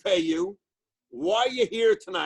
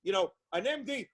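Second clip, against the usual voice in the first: ordinary talk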